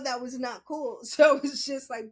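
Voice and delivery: ordinary talk, nothing marked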